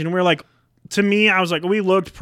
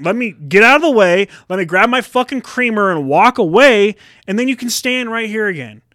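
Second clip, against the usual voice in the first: louder, higher irritated rant; exasperated demand; 6 semitones higher, 5 dB louder than usual. Acted. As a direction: push past him with rising exasperation. Retold argument at the creamer.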